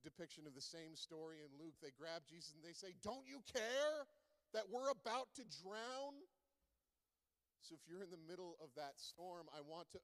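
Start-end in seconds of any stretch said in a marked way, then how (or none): 3.0-6.2 high, loud, slow accusing cry; desperate plea. Acted. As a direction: plead in panic over the waves. Disciples cry out in storm.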